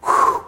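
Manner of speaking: breathy, pitchless whew; acted exhale of relief; sigh of relief. Voicing relief at absolution.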